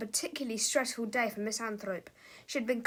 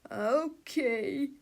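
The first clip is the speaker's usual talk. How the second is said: whiny. A narrator's reading. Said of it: "whined Mister Melvin".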